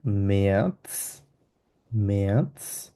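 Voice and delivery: ম্যাথস as a slow "me-ats". Shown as a demonstration. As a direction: draw out ম্যাথস, ending on a sharp TS. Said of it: "ওটাকে আমরা এস এর মতো উচ্চারণ". (ম্যাথস ধীরে, শেষে "ৎস")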